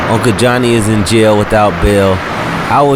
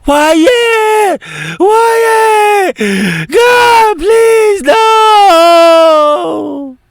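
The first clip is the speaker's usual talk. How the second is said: high wailing cries; screaming and crying; 3 dB louder, much slower than usual. Acted. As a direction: scream his name in grief. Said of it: "Viera screaming and crying".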